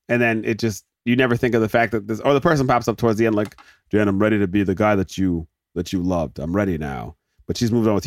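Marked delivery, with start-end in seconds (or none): 3.9-7.1 mock earnest confession. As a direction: confess love gently, voice sinking. Acted rom-com love confession.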